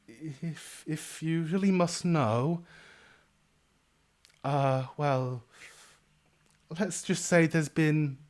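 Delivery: motherly innkeeper suddenly reluctant; hesitant innkeeper. Acted. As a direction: let the cheer drain, reluctant to say.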